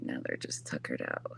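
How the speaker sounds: whispered, barely voiced; hushed so the kittens sleep; whispering over a crib. Real, not acted.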